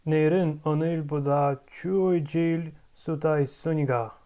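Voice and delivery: 내일은 오늘보다 추워질 수도 있으니까 run together quickly; rapid native-pace Korean sentence; fast fluent Korean. Shown as a demonstration. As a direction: rattle off the Korean line fast. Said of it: "let's try to build the entire stuff".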